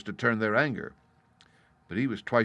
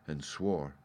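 ordinary delivery, nothing marked

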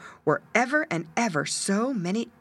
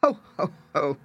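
terrible voice; 3 semitones lower than usual. A narrator's reading. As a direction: cry it out like a terrible cat. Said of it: "cried the cat in a terrible voice".

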